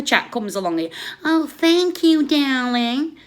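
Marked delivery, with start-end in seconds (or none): 1.2-3.2 higher, slow sugary mock gratitude; a hint of a gushing streamer's accent; lightly done syrupy thanks to a donor; mock thank-you. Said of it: "Now, I can't do the accent".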